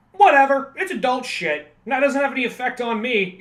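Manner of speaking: high, loud, dismissive brush-off; dismissive kid. Retold or imitated. A kid brushing off adult themes in movies, loud and dismissive. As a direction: shrug it off like a bored kid.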